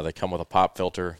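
normal talking voice

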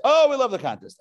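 high, loud mock enthusiasm; eager crowd. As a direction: pipe up like a thrilled crowd. Voicing the crowd's eager reply.

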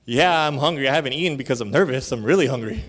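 loud, eager yes; loud, rushed eagerness. Retold or imitated. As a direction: blurt it out, desperate to please. Eager answer to the boss.

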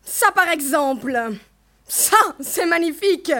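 loud, outraged sarcasm; hoarse with anger. A narrator's reading. Mother erupting at her daughter's plea, hoarse with anger. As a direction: explode in angry, hoarse indignation. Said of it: "d'une voix enrouée de colère".

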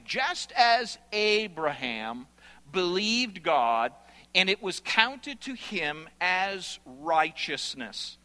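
solemn scripture reading. Acted. Quoting scripture slowly, solemnly.

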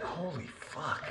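soft, slow, stunned aside through a laugh; awed hushed curse. Retold or imitated. Overwhelmed freshman muttering about classmates, slow and hushed. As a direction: breathe it out in amazement, half laughing. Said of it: "and I was like".

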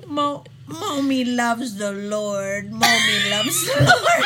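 mimicking a mom's gushy voice for her dog. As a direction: gush to the dog in baby talk, cracking up. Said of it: "in this dog voice".

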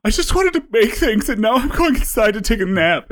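high-pitched, whiny mock crying; hammed-up tearful little voice; mock weeping child. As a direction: imitate yourself whimpering like a little kid. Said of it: "weeping like a child".

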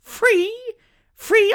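very high, loud repeated free; shrill beeping free free free; high-pitched squeak. Pretend. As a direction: squeal it like a lorry reversing. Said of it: "in such a high-pitched voice".

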